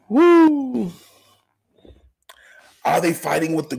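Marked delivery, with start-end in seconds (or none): 0.1-0.9 high loud whoop; gleeful high whoop. Real, not acted. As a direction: let out a big whoop. Whoop after laugh.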